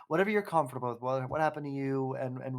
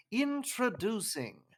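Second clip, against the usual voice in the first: slow, higher English-accent intro; English-accent announcer; 8 semitones higher, slower than usual. Shown as a demonstration. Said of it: "or, like".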